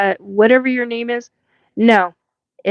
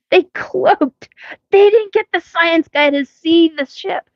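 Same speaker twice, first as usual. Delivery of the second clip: exasperated complaint; 8 semitones higher than usual. Retold or imitated. Retold frustration at the ship.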